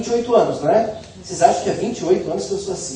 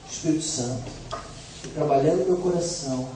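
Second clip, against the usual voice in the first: ordinary delivery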